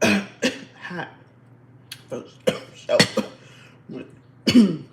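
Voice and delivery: coughing through the words; choking on food mid-sentence. Real, not acted. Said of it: "the wrong pipe".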